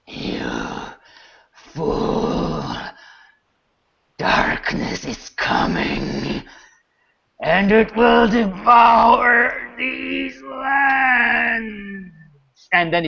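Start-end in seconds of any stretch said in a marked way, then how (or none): 0.1-6.4 slow, whispered creature rasp; dying dragon. Acted. Dying dragon's last words. 7.4-12.1 high, strained, very drawn-out cry; dying dragon's roar. Acted. Dying dragon's final curse.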